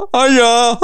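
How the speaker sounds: high, giggly, embarrassed hello; bashful high hiya, breaking into a laugh; sheepish laughing hiya. Retold or imitated. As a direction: squeak it out with a nervous laugh. Everyone looks at him, retold.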